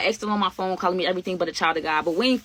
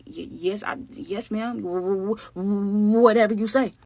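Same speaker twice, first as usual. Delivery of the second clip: nervous stutter; slower than usual. Retold or imitated. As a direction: stammer it out meekly to the boss lady. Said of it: "I really used to stutter".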